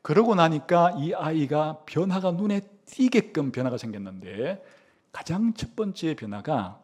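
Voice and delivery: ordinary talk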